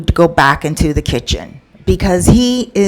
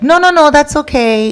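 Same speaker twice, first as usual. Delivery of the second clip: higher, polite no no no; polite refusal; 9 semitones higher than usual. Retold or imitated. Girlfriend turns down the manager's offer, high and polite. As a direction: wave him off politely.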